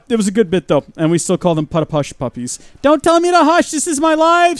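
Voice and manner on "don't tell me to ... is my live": high, loud mock protest; playful squeal of protest; mock indignant. Real, not acted. Streamer teasing chat with a playful protest.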